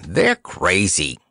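usual speaking voice

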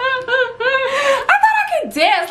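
sehr hoch, langsam, lachend; laughing. Real, not acted. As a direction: lachend aufschreien. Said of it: "laugh cry".

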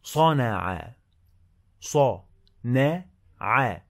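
slow, clear dictation of each word; dictating. Shown as a demonstration. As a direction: read each word slowly for a dictation test. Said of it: "It's a dictation activity".

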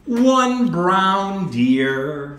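slow, over-clear teacher voice; slow clear modelling. Shown as a demonstration. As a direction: say it slowly for a child. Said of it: "teacher models phrase slowly".

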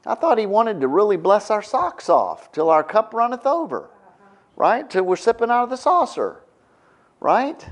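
high-pitched feigned bewilderment; mock naive disbelief. Preacher voicing believers expecting blessings, mock naive disbelief.

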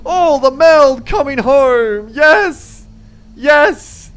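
high, loud triumphant cheer; joyful cheering. Real, not acted. Gamer cheering a flawless mission, high and loud. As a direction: celebrate a perfect mission at full voice.

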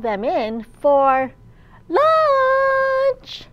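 lively picture-book storytime voice; reading to children. A narrator's reading. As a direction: read it aloud to a room of little kids.

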